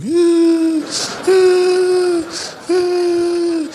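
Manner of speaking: long, high sustained wail; drawn-out high whine of a beaten child; mock child crying. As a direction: keep up a steady put-on cry. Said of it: "crying is one of the most important parts".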